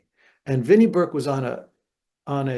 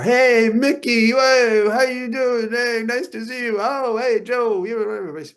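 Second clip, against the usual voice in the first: much higher, louder fawning greetings; excited fans; 10 semitones higher, 5 dB louder than usual. Retold or imitated. Bar guys excitedly greeting Mickey Mantle, high gushing voices. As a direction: gush like starstruck bar regulars.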